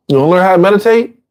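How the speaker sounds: high and fast, chirpy; quick cheerful offer. Acted. Teacher voicing a friend offering meditation to an addict, fast and high. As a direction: toss out the offer brightly and quickly.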